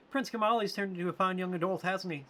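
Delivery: high motherly attendant. Acted. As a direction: pitch way up as a fond caretaker talking to Mort. Voices female character.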